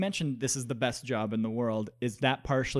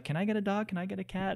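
high-pitched mock begging, like a kid; pleading child; 7 semitones higher than usual. Kid asking parents for a pet, pleading. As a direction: pester the parents in a higher voice. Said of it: "I've been trying to convince".

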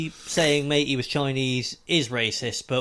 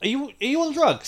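much higher, disbelieving; incredulous high quote; 13 semitones higher than usual. Retold or imitated. Friend mocking the girl, high incredulous squeak.

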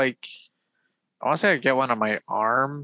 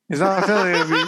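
laughing echo; 7 semitones higher, faster than usual. Retold or imitated. Mocking echo of friend's line.

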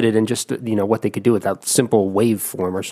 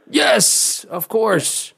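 excited; 12 semitones higher, much slower than usual. Real, not acted. Host confirming the right answer.

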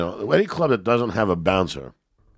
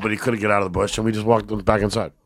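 normal talking voice

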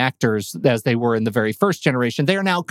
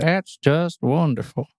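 granny voice pitched down. Pretend. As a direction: bring the old lady down a notch. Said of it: "Pitch it down a little bit".

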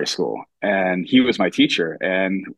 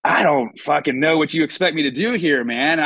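higher, fed-up rant to a friend; mock-annoyed outburst; exasperated retort; 6 semitones higher than usual. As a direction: snap back at a pal who won't get it. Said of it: "I'm like".

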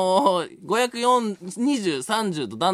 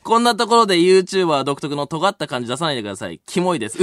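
ordinary talk

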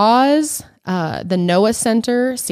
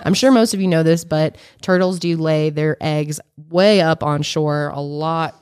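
usual speaking voice

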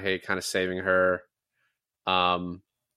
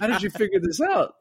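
mimicked astonishment; 10 semitones higher, 4 dB louder than usual. Acting out film character's astonished question.

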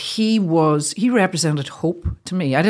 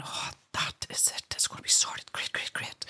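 breathless whisper of relief; whispered inner thought; 14 dB quieter than usual. Retold or imitated. Recalling her hope dad would be fixed, whispered. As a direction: whisper it to yourself, giddy with relief.

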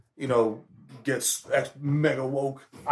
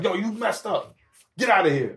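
raised, abrupt mock telling-off; mock scolding dad; 6 semitones higher than usual. Dad dismissing kid without any context, mock scolding. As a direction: imitate a dad snapping with no explanation. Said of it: "Not, like, just like".

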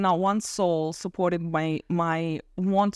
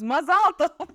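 high, laughing imitation of a cheery father; upbeat congratulation; 8 semitones higher, 4 dB louder, slower than usual. Father reacting to the fire as good news, imitated cheerfully. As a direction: call it out brightly through a laugh. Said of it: "because he was a positive person".

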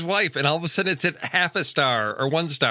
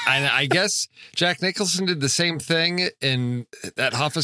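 ordinary talk, nothing marked

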